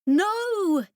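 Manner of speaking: high, loud cry of protest; shout. Acted. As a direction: yell it to stop him. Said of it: "Jane shouted".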